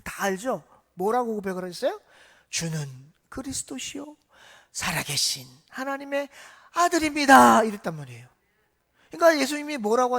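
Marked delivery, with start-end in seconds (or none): 2.5-7.6 starts whispered and slow, ends in a loud burst; building confession. Acted. Peter confessing to Jesus, whisper swelling to a loud cry.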